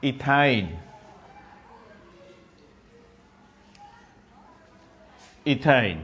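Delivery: ইথাইন said twice, clearly as "ithain", falling each time; pronunciation demo. Shown as a demonstration. Teacher repeating ইথাইন so the class can copy it, careful demo. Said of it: "এটার উচ্চারণ হবে".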